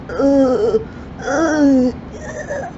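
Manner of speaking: high strained groans fading to a breathy sigh; old person groan. Shown as a demonstration. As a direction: groan like getting up from a chair is hard. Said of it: "I make this old person noise".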